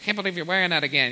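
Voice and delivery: quick, catty mock remark; snarky friend. Mocking a judgmental friend, quick snark. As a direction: tease like a judgy friend. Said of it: "Sean's just like".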